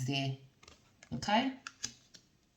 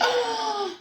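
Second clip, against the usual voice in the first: gasp. Real, not acted. Reader gasps at a card.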